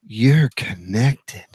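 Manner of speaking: low, very slow announcement voice; headphone voice prompt. Retold or imitated. Host imitates his headphones switching on, slow low prompt voice. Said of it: "They go".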